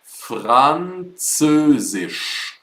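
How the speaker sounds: Französisch, spoken slowly with rolled uvular R and clear ts; slow teacher demo of Französisch; careful German word. Shown as a demonstration. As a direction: spell out Französisch sound by sound, very slow. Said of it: "French is really difficult in German".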